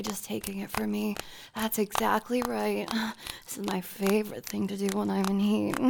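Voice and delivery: ordinary delivery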